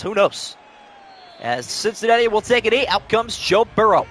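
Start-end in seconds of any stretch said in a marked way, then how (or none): 1.4-4.1 lively sportscaster calling the play. Acted. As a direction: call it like a TV broadcaster. Madden play-by-play call.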